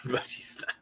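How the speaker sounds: laughing whispered admission. Real, not acted. Admitting Jack Frost is bad, whispering.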